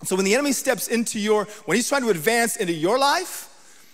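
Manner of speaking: ordinary delivery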